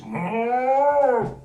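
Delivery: high drawn-out moo; calf moo. Acted. Calf calling to the cousins, a high moo.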